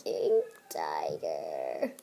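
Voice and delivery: normal talking voice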